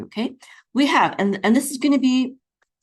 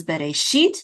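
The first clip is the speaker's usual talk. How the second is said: "Bereshit" with the accent on the final "shit"; stress on last syllable. Shown as a demonstration. Teacher shows where the accent falls, final stress. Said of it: "the accent is on the last syllable".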